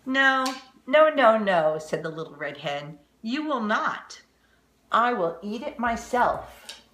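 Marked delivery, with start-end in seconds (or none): 0.1-1.8 loud, high no no no; loud refusal. Acted. Hen refuses loudly. 3.2-6.8 firm hen. Acted. Hen refuses firmly.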